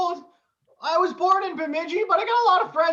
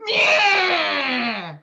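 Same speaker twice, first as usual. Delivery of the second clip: aggressive meh. Acted. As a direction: bark the meh at the sneeze. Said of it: "I aggressively go".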